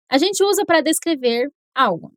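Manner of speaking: ordinary talk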